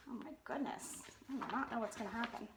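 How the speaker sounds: ordinary delivery, nothing marked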